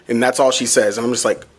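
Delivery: ordinary talk, nothing marked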